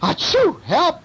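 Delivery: very high cry for help; burglar's yell. A narrator's reading. Burglar yells as cat lands on him, acted panic. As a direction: sneeze and yell in storybook panic. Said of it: "yelled the burglar".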